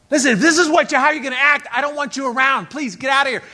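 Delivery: high, exasperated scolding, easing in volume; annoyed. Acted. Apostle telling off a pestering girl, annoyed. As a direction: scold her with frayed patience. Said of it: "Paul was annoyed".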